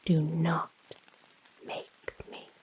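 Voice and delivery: usual speaking voice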